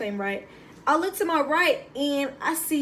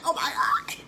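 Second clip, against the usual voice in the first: much higher, breathless laughing squeal; excited squeal. Retold or imitated. Recruit thrilled to get her jacket, squealing with laughter. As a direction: squeal with joy at the new gear. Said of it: "I was so excited".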